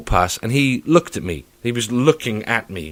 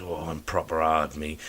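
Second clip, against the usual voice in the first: mock tough guy; 6 semitones lower, 6 dB quieter than usual. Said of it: "this face on that goes".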